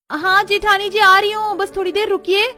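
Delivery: put-on high-pitched young bride calling through a door. Bride stalling sister-in-law from behind the door, calling high. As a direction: pitch it up and call out.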